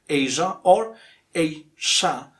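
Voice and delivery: Asia as AY-zhuh, then AY-shuh; slow "Asia", first with zh, then with sh; two correct variants. Shown as a demonstration. Teacher offering both accepted ways, two variants of Asia. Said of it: "it is pronounced zhi or shi".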